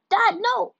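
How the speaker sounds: high, loud scream at dad; desperate shout. A narrator's reading. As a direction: cry out to stop him. Girl warns father.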